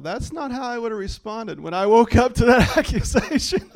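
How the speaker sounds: real amused laugh while telling. Real, not acted. Laughing at own reaction.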